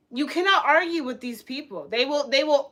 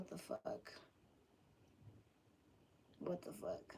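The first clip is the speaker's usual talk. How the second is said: stunned whisper; 5 semitones lower, 28 dB quieter than usual. Real, not acted. Stunned reaction to chat.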